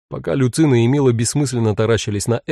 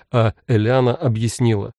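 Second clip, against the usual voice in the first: usual speaking voice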